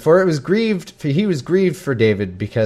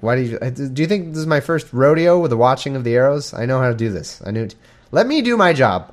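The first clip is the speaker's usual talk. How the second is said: ordinary talk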